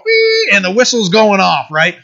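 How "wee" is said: a sudden high whistling squeal; mock kettle whistle. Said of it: "the top is going".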